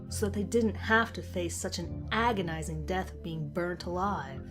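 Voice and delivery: plain reading voice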